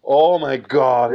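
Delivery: slow, loud relieved sigh of words. Real, not acted. Dad remembering the scare, slow relieved exhale. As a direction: let all the tension out. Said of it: "I was so relieved".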